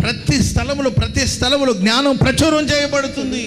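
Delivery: high-pitched, ringing declaration; preaching proclamation. Acted. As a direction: proclaim the verse to the whole street. Preaching at full force. (ఎత్తైన స్వరంతో వాక్య ప్రకటన)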